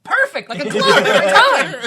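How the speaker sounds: very high, loud delivery through laughter; gleeful quoting. Acted. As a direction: crow it out while cracking up.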